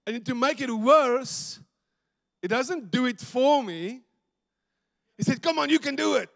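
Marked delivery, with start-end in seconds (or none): 5.4-6.3 high, loud egging-on; acted-out rousing shout; spotter's encouragement. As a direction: cheer him on like a gym spotter. Spotter urging him on, acted.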